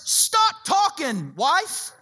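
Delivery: slow, pointed stop talking; drawn-out command. Retold or imitated. Husband telling his wife to hush, drawn out. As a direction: Stop talking stretched very slowly and high. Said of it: "in other words".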